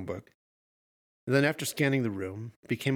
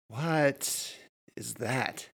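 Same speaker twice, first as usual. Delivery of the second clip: very slow, halting question; stammered; 2 semitones higher, much slower than usual. A narrator's reading. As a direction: falter between the words in shock. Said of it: "he stammered".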